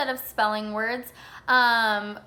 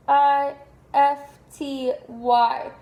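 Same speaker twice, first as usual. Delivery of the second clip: spelled out slowly; 3 semitones higher, 5 dB louder, much slower than usual. Shown as a demonstration. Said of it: "teacher spelling letters slowly".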